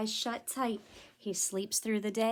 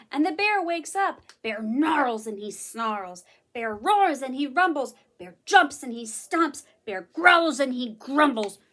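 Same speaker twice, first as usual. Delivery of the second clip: booming growling read; loud and rumbling; 9 dB louder than usual. A narrator's reading. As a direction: build to a loud grumble. Angry bear woken.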